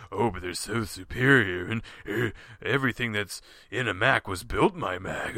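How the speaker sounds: low, put-on smug defender voice; mocking Apple fans. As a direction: mimic a Mac devotee in a low voice. Mocks what Apple defenders say.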